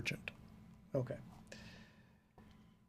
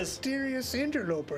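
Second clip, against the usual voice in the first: higher-pitched merchant voice; shopkeeper character; 11 semitones higher, 4 dB louder than usual. Acted. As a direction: echo it in a put-on shopkeeper voice. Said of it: "We all talk like this".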